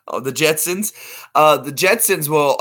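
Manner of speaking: normal talking voice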